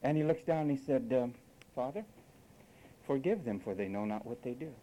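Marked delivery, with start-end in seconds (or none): 1.7-4.7 quiet, even forgiving voice; calm, unemotional. Retold or imitated. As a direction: speak it softly, without any emotion. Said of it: "Man, that's unemotional".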